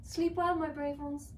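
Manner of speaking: high, loud motherly goodnight; mother dragon. Acted. Mother dragon tucking in her babies, high and loud. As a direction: sing out goodnight to the little ones. Maeve tucks in little dragons.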